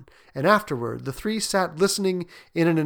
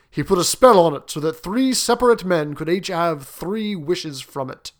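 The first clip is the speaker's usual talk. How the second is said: louder, weighty storytelling; impressive old soldier; 2 semitones higher, 6 dB louder than usual. Acted. Soldier telling his hosts of the fakir's spell, impressively. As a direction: sergeant major lending the tale gravity. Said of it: "His manner was so impressive".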